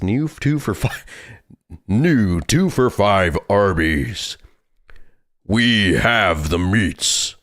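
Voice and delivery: slow, drawn-out mock commercial voice; mock ad announcer. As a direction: read it like a cheesy fast-food ad, very slowly. Reads fake tape as Arby's ad.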